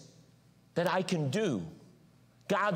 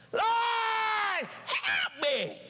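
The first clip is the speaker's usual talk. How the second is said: desperate cry to the Lord; 13 semitones higher, much slower than usual. Pretend. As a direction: beg the Lord at the top of your voice. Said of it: "I'm like".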